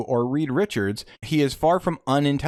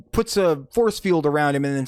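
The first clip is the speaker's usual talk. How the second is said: usual speaking voice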